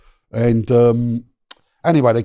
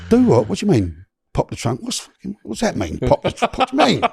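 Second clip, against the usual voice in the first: higher, baffled questions tumbling out; 9 semitones higher than usual. Retold or imitated. Brit to an American cop who says pop the trunk, higher and baffled. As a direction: play it lost and incredulous at a strange phrase. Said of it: "So I'm like".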